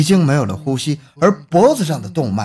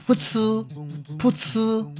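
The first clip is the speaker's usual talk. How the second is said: spurting sound; 7 semitones higher, 7 dB quieter, much slower than usual. Acted. Mouth sound of spurting blood.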